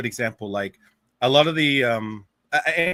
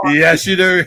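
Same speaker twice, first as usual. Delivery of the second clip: higher and much louder, laughing as he says it; laughing tease; 8 semitones higher, 9 dB louder, slower than usual. Real, not acted. Guest laughing as he insists the host sounds bad. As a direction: laugh it in his face.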